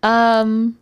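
mid-yawn. Real, not acted. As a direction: yawn through the word. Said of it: "you're yawning".

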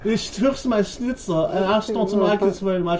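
high, hammed-up German voice; mock German accent. German sailor whining about his stolen schnitzel, mock German accent. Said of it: "That's German".

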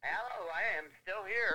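tinny caller on speakerphone; distant voice over the phone line; phone speaker. Real, not acted. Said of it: "now you're on speakerphone".

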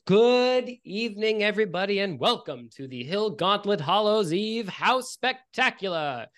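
high, loud, slow drawn-out proclamation; projected witch announcer. Acted. Witch greets the festival crowd, magically projected announcer voice. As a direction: welcome the whole lawn like a grand witch host. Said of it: "her voice is magically projected across the whole lawn".

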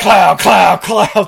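loud vocal gunshot noises; mock gunshots. Podcaster imitates the cop randomly firing, mock gunshots. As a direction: fire off loud pretend pistol cracks.

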